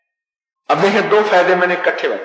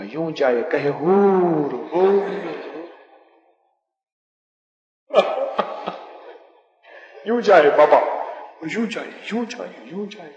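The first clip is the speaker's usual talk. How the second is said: put-on swaying old man; 3 semitones higher than usual. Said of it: "اس نے جھومنا شروع کر دیا".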